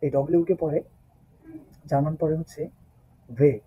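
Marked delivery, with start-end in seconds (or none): 3.3-3.6 বে said soft, lips and teeth, not hard; German W, বে. Shown as a demonstration. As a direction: say বে gently, never a hard b. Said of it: "hard করে বলবেন না".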